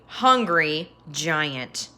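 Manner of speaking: ordinary delivery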